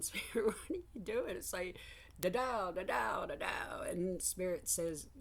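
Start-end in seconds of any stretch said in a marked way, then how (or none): 0.0-1.4 higher, quick, half laughing plea; pleading to Spirit. Retold or imitated. Asking Spirit what it is doing, mock baffled plea. 2.2-3.8 mock humming. Mimicking Spirit's vague answer.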